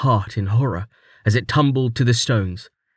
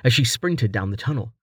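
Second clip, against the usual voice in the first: ordinary delivery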